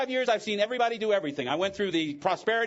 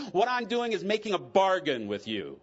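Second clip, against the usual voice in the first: usual speaking voice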